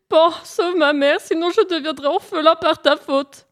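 high, wailing reproach; hammed-up weepy begging, pitched right up; tearful. Hare begs Pan to save his mother, tearful. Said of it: "en pleurant encore plus fort".

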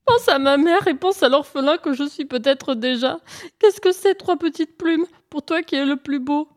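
high, hammed-up moaning plea; whining. Hare flatters Pan and begs for feathers, whining. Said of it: "se mit à gémir bruyamment".

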